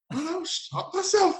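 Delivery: very high, slow incredulous cry; hammed-up disbelief; mock exasperation. Young player answering the veteran, high incredulous mock cry. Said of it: "I was like".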